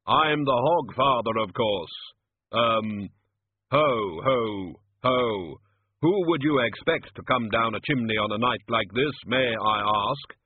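slow, measured, weighty voice; ponderous Death posing as Hogfather. Acted. Death insists to wizards he is the Hogfather, solemn ponderous voice. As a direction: intone it solemnly, keeping up the pretence.

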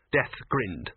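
plain reading voice

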